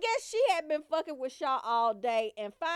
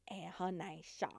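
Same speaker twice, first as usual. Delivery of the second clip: whisper; 7 semitones lower, 15 dB quieter than usual. Real, not acted. Whispered aside naming the cousin.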